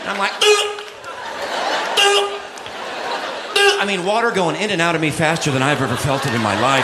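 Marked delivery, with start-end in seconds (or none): 0.4-3.8 high yelp ooh. Retold or imitated. Dragged behind the boat.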